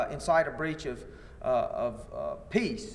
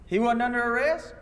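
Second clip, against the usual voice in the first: raised, higher questioning pitch; mock disbelief; 6 semitones higher than usual. Plaintiff's lawyer questioning an officer in deposition, mock disbelief. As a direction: imitate an incredulous cross-examining lawyer.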